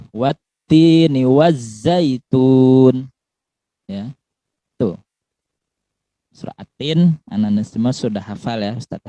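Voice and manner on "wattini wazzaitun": very slow, louder recitation; za in wazzaitun. Shown as a demonstration. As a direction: recite "wazzaitun" slowly with clear z. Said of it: "ini pakai za". ("wazzaitun" dibaca lambat dan jelas)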